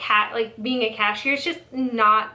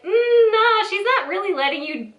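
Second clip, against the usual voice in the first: sassy dismissal; 9 semitones higher, 3 dB louder than usual. Retold or imitated. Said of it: "And I'm like".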